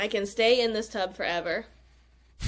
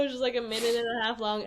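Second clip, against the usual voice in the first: usual speaking voice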